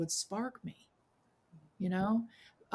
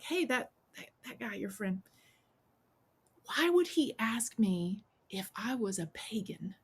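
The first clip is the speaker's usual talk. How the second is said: secretive whisper; 2 semitones higher than usual. Retold or imitated. Teen asking a stranger about his friend, whispering. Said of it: "and I'm like".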